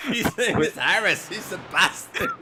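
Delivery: hammed-up loud quote; mock shout. Quoting the ostrich video man, mock shouting.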